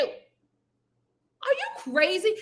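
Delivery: are you crazy, high and very slow; incredulous. Real, not acted. Reacting to the sister, high and drawn out. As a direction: stretch out your disbelief.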